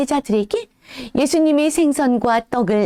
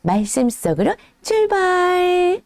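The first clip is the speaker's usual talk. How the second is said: slow, higher, sing-song call to start; cheery kickoff; 6 semitones higher, slower than usual. Acted. Host calling kids to set off on the story, bright and slow. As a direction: launch the adventure for the kids.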